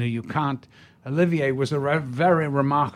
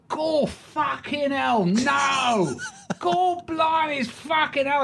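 hammed-up Cockney pub heckler, pitched way up; Cockney fan; 13 semitones higher than usual. Cockney stranger barging into the pub snug, high hammed-up Cockney. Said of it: "this Cockney comes in".

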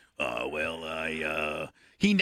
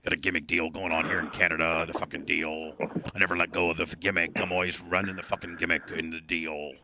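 low, breathy wrestling heel voice growling "brother"; Canadian wrestler doing his heavy-breathing gimmick; 8 semitones lower, 4 dB quieter than usual. Acted. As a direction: cut a promo as a gruff wrestler.

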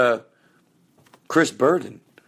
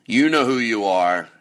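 ordinary delivery

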